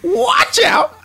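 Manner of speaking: very high shouted squeal; hammed-up falsetto warning; Hungry Dude. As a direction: yelp it like a cartoon character. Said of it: "now Hungry Dude is".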